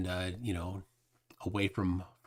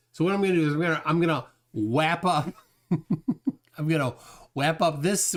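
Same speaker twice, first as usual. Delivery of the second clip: laughing through it; 3 semitones higher than usual. Real, not acted. Laughing while trying to sign off.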